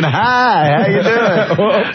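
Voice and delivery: high, slow sugary hello; mock sweet greeting. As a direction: fake innocent welcome. Mocking a sweet cover-up greeting.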